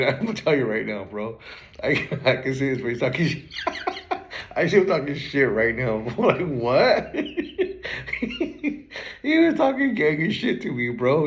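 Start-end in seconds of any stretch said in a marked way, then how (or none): 1.8-11.3 higher-pitched words between bursts of laughter; breaking up laughing; real laughing fit. Real, not acted. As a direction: keep telling it while cracking up. Laughing while recalling the teasing.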